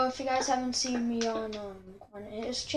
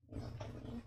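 whisper; 15 dB quieter than usual. Real, not acted. Aside dropped to a whisper.

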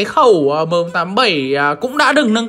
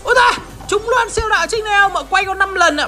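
phấn khích; 9 semitones higher, faster than usual. Real, not acted. Trúng đồ xịn khi quay.

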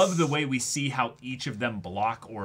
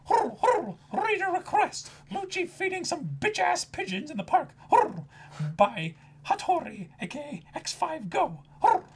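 high, cooing pigeon voice with hurr grunts; 4 dB quieter than usual. Retold or imitated. To her friend, voicing Hattori the pigeon, high and cooing. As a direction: play Hattori as a smug pigeon.

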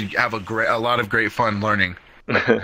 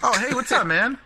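bright greeting; 12 semitones higher than usual. Real, not acted. Greeting rude stranger brightly.

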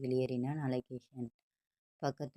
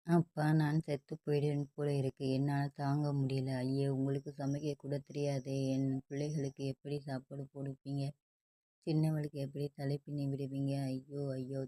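ordinary delivery

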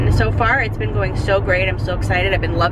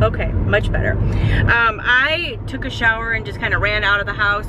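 ordinary talk, nothing marked

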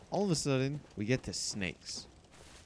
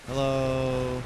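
put-on greeting voice; 3 semitones higher, 7 dB louder than usual. Greeting an enemy in a voice.

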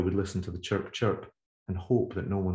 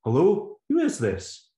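puzzled man on phone; 11 semitones higher, 5 dB louder, slower than usual. Acted.

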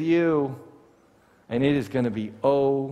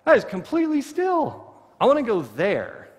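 higher, dreamy admiration; mock awe; 8 semitones higher than usual. Viewer admiring a still river photo, mock awe. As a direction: gush over a pretty photo. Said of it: "you're like".

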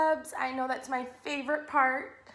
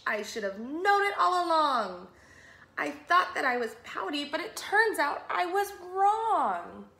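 louder, rising delight; joyful realisation; 3 semitones higher, 3 dB louder than usual. Acted. Mister Fish telling his friends he was wrong, joyful. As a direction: proclaim the happy discovery.

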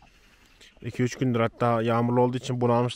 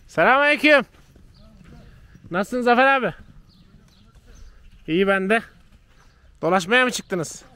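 loud, high call across the field; calling out a greeting; 9 semitones higher, 6 dB louder than usual. Real, not acted. Vlogger calling a greeting to Zafer abi from far off. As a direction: hail him from a distance.

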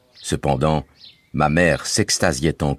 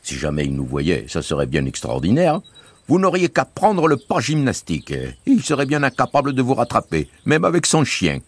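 put-on higher, lively voice for the boy Bouzig; Bouzig's voice; 9 semitones higher than usual. A narrator's reading. Bouzig reassuring the family, bright boyish voice. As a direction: play the cheerful young guide Bouzig.

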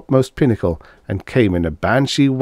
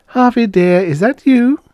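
high, louder tender woman's call; Dana calling; 6 dB louder than usual. Acted. Dana calling down to Harvey from the airlock, high and sweet. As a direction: ask sweetly into the dark.

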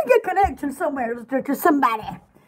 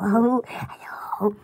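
whisper; 7 semitones lower, 6 dB quieter, much slower than usual. Acted. Softly greeting.

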